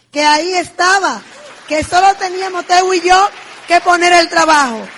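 excited. Real, not acted. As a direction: build it up with conviction. Climax of testimony.

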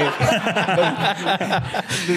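long laughing burst; real laughter. Real, not acted. Friend laughing after teasing the storyteller, amused.

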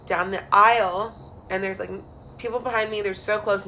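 ordinary delivery

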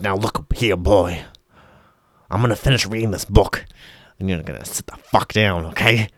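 menacing. Acted. Joe threatens boy at gunpoint.

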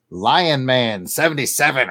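normal talking voice

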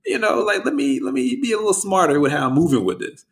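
high-pitched voicing of someone reasoning to himself; imitated self-caution. Podcaster voicing an artist's second thoughts, high-pitched imitation. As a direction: pitch it up high and hold it there.

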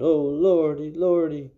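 high, loud fearful moan; scared gravedigger moaning; frightened moan. Acted. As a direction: groan it out in terror. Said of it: "the gravedigger moaned".

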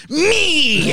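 high, loud shout of Me!; climax cry. Retold or imitated. Comedian shouting at his own reflection, high loud cry. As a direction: belt out Me! like peaking in the mirror.

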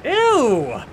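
high, loud hammed-up ew; mock disgust. Shown as a demonstration. Mocking a complainer's disgust, loud high ew. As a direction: recoil in exaggerated disgust. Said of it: "talking like that".

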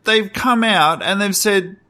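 worked-up rant, pitched high. Real, not acted. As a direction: rage about the game. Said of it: "Are you gonna let me rage?".

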